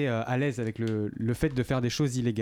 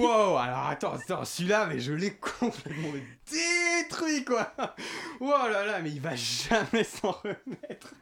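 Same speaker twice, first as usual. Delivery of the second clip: mock triumph; 7 semitones higher, slower than usual. Hammy self-congratulation after punchline.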